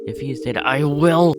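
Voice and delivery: Maria, scared for Alduis, shouts her threat at Kang; angry outburst. Acted. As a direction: threaten him, voice breaking into a yell.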